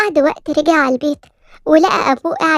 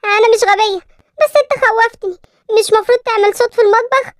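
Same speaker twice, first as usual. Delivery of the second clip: put-on higher, childlike voice of a hurt little girl; a small girl complaining to her uncle, a little upset; scared little girl telling her uncle why she is upset; 7 semitones higher than usual.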